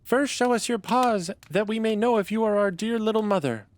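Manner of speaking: put-on a little kid reassuring its mother, very high; little goat kid voice. A narrator's reading. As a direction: squeak it out like a small goat child. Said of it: "The little kids cried".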